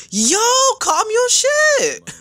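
very high, drawn-out yelp; alarmed outburst. Real, not acted. Fan telling an on-screen demon to calm down, alarmed. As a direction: plead slowly, way up high, in alarm.

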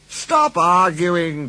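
slow, loud, high complaint; grumbling. Acted. Impatient passengers break up the argument, grumbling. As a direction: draw it out crossly and loudly. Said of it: "grumbled the passengers".

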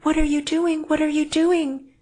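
high, loud alarmed woman; horrified cry. A narrator's reading. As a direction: cry out in horror at him. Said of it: "she cried in horror".

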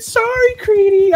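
high, breezy sorry as she rides past; mock Nora. Mimicking Nora running him over on her horse, high and airy. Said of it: "she ran me over and said".

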